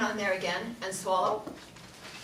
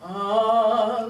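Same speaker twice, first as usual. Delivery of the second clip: louder, lower oh; low note oh; 5 dB louder than usual. Shown as a demonstration. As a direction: drop the oh down low. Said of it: "lower notes sound".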